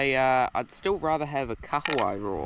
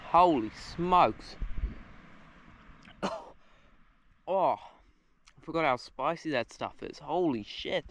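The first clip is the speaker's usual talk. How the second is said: strained, slow, higher gasps after coughing; choking on wasabi; 4 semitones higher, slower than usual. Real, not acted. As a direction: talk through a burning mouth. Too much wasabi, coughing.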